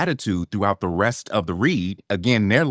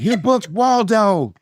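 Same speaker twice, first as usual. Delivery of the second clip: high, drawn-out agent's announcement; big news reveal; 13 semitones higher, 3 dB louder, slower than usual. Retold or imitated. Agent phoning the actor with surprise casting news, excited. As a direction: stretch out the surprise news, pitched way up.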